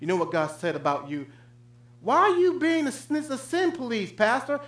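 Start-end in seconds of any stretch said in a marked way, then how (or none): 2.0-4.6 high, mock protesting listener; mock whine of a churchgoer who feels accused; mock complaining congregant. Preacher voices a churchgoer objecting to the pastor, high and complaining. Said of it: "congregant objecting to pastor".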